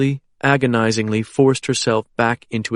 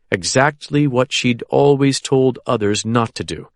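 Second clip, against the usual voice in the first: plain reading voice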